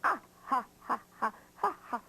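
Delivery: mocking string of ha-has; carefree, staccato pretend laughter; acted bear laugh. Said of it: "he just kept on laughing and laughing".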